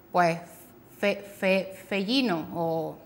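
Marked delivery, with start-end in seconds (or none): 1.0-2.5 "fe-, fe-, fellino", stumbling and then dropping the r cluster; garbled word. Retold or imitated. Therapist imitating a child mangling frenillo. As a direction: stammer "fe-" twice, then say "fellino". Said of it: "Suelen cambiar la palabra".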